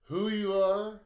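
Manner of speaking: voicing the dog Sparty, high and loud. The dog Sparty lecturing Gabby in a high, loud voice. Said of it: "Sparty says".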